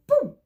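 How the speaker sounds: loud high boom effect. A narrator's reading. Teller voicing the knife strike with a loud boom. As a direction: punch the sound out. Knife thrown over heads.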